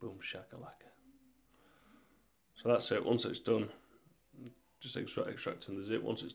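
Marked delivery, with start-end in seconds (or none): 0.0-0.9 muttered softly under the breath; quiet repeat. Retold or imitated. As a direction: throw it away, barely audible. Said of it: "Yeah, you heard it".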